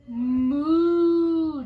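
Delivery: loud, long drawn-out moo; cow moo. Shown as a demonstration. Mother showing toddler a cow, loud drawn-out moo. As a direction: stretch out a big cow moo. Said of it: "This is a cow".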